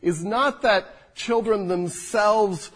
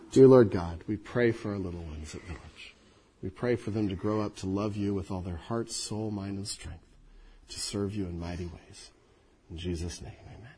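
prayer; 10 semitones lower, 7 dB quieter than usual. Real, not acted. Pastor praying over the children gathered round, soft prayer. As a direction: pray gently, hands on little ones.

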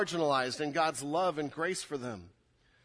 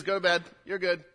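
usual speaking voice

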